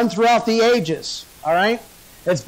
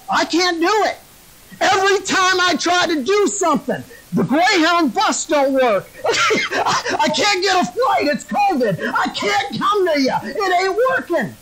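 high, exasperated comic whine; hammed-up frantic excuses; 9 semitones higher than usual. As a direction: reel off mock excuses in helpless desperation. Balaam making comic frantic excuses.

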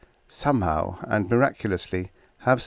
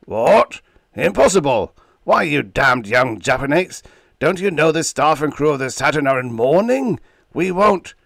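high, loud furious roar; 7 semitones higher, 7 dB louder than usual. Acted. Old captain roars into the phone, high and loud. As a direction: bellow it in outrage at the clerk. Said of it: "and he roared".

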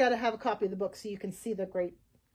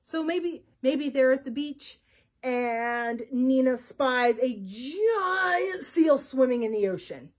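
usual speaking voice

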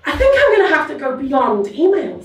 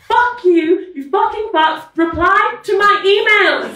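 mock furious shout; 8 semitones higher, 3 dB louder, slower than usual. Mock subject line shouted.

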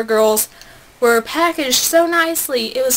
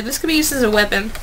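normal talking voice

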